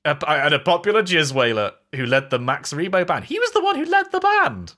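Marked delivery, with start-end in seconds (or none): 3.2-4.7 high squeaky voice. Pretend. Pitched-up aside.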